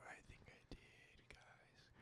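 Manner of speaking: hushed whisper to the camera; giggly prank whisper. Real, not acted. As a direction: whisper it like a guilty secret. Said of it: "Why are you whispering into the mic?".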